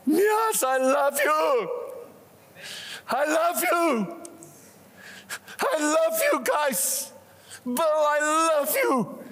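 Jesus shouting out. Acted. Jesus shouts love to each one in church, calling out. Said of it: "how he shouts out".

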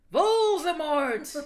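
loud, high, slow reveal; dramatic announcement. Acted. Reading the fanfic aloud, loud mock-dramatic reveal. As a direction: boom out the villain's name.

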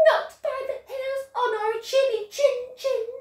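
high, fast piggy squeak; squeaky pigs. Acted. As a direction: rattle it off as three tiny pigs. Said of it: "yelled the three little pigs".

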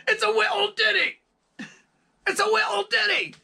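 high, loud falsetto Scottish impression, "little ditty" squeezed to "wee-l dinny"; impression of a Scottish singer. Mimicking [name] mimicking the Outfield singer, shrill Scottish falsetto. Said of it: "impersonating the singer from the Outfield".

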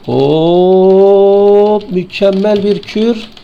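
high, loud, drawn-out hammed-up hoot; mock triumphant cheer. Gamer mocking his own combo, a hammed-up cheer. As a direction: crow it like a cartoon victor.

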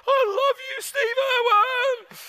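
high, loud, squealing fan declaration; a hammed-up starstruck squeal; mock fan outburst. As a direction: cry out like a swooning fan. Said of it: "I just about stood up ... and said".

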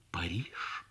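plain reading voice

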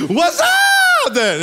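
high, loud, drawn-out holler; big comic yell of joy; exuberant shout. Acted. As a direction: whoop it out, stretched long and high. Acts out his excited reply to God.

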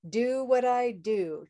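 slow, stretched-out model line; slow demonstration. Shown as a demonstration. Teacher modelling a line for learners to repeat, slow and stretched. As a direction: draw each word out evenly for others to copy. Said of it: "try that".

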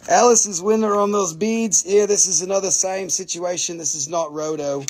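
normal talking voice

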